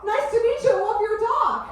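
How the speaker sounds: bubbly American mum chatting to a dog walker; mum's friendly gush. Retold or imitated. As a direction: son doing his chatty American mum, gushing. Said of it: "she'll be like".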